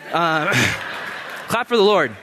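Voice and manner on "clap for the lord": ordinary talk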